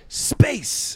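high breathy whisper of Space. Retold or imitated. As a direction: hiss out Space like a sci-fi trailer. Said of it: "Mike goes".

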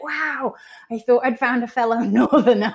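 high, light amazed wow; imitating someone pleasantly taken aback, high-pitched; mock surprised reaction. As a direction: play the let-down stranger with a laugh. Reaction of disappointed strangers acted.

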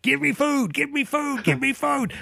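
put-on high, whiny toddler chant for food; demanding baby. Dad imitating his hungry baby son, high whiny chant. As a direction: beg like an impatient toddler. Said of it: "he goes, he's giving, like".

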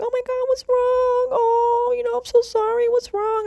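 high-pitched, fussing mom impression; worried mother. Mom comforting her sick child by phone, mock-worried. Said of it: "she panics".

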